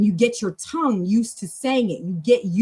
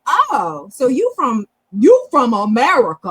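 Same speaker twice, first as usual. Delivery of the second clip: animated mock astonishment, growing louder; surprised reaction; 3 semitones higher, 7 dB louder than usual. Retelling the dialogue to her practice partner, mock surprise. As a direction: act out a surprised realization, building to a loud question. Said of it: "He's like".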